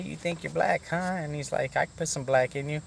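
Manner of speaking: high-pitched imitation of a taunting joker; joking taunt. Naked inmate taunting him in the shower, jokey. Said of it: "trying to be funny and shit".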